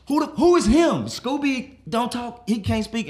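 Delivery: high, loud disbelief; incredulous outburst. Acted. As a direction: fire back in high-pitched exasperation. Acted disbelief at guest's answer.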